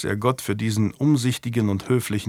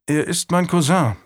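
put-on higher-pitched boy's reply; lightly voiced lad explaining himself; young squire; 6 semitones higher than usual. A narrator's reading.

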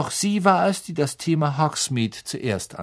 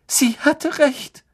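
higher, louder, slow tearful wail; 5 dB louder, slower than usual. Acted. Lavender wailing that the prophecy came true, distraught. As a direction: let the grief break out.

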